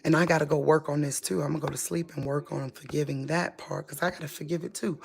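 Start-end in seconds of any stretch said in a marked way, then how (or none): 1.4-5.0 worn-out murmur. Real, not acted. Tired, withdrawing to sleep.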